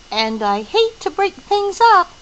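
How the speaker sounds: can barely contain the news; quivering excitement. Acted. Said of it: "His voice quivered with excitement".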